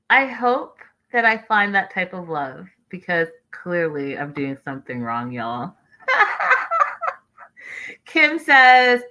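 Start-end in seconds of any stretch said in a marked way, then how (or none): 6.1-7.1 high-pitched cackling laugh. Real, not acted. Host laughing at her own love life, high giggle. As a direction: burst into a rapid high giggle.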